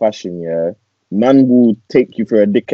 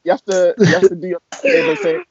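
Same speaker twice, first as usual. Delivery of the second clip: high excited rant; 3 dB louder than usual. Real, not acted. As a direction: pile it on, rising.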